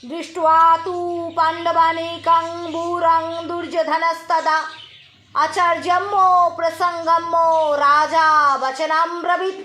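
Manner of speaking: slow, steady Sanskrit chanting; Gita recitation. Acted. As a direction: intone each verse like a temple reading.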